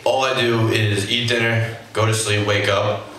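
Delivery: normal talking voice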